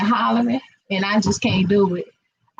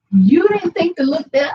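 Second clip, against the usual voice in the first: put-on higher, a touch louder incredulous young woman; teasing daughter; 7 semitones higher, 3 dB louder than usual. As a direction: imitate the daughter poking fun at mom. Daughter quoted teasing mom.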